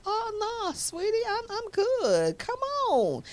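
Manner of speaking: high, slowed, playful mock Harvey voice; breezy celebrity charm, lightly put on; imitating Steve Harvey. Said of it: "He was like".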